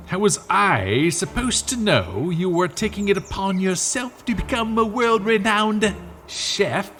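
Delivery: mock-outraged squabbling over cooking; indignant outburst. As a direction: throw the blame back, rising in pitch. Acted bickering over badly cooked beans.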